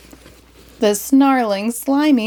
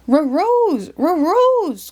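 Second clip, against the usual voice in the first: much higher dog voice, R for H; Scooby echoing; 9 semitones higher than usual. Acted. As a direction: bark "Rose" like a cartoon dog. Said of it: "Scooby joined in".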